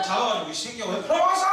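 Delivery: loud, high, raging shout; furious yell. Pretend. An imagined Jesus yelling at Peter, a loud mock rage. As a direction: yell at the traitor, laughing through it.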